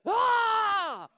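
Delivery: sudden high loud scream; hammed-up preacher yell "Ah!"; imitated preacher's scream. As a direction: let out the pastor's big preaching scream. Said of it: "Kick his foot and scream".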